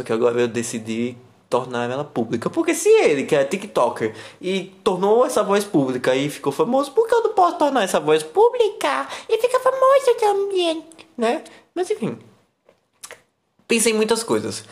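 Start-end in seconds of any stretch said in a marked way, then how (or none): 2.5-3.2 squeaky voice. Pretend. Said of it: "essa vozinha". 6.9-12.2 very high, squeaky voice; squeaky little voice. Pretend. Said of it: "essa vozinha".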